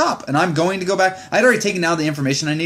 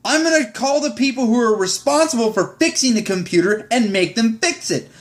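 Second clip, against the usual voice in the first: ordinary talk, nothing marked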